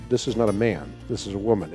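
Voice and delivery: usual speaking voice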